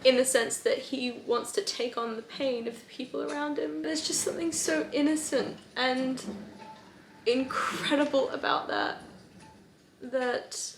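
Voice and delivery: holding back tears, voice catching; close to tears. Real, not acted. Vlogger telling viewers she's about to cry, choked up. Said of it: "is making me really sad".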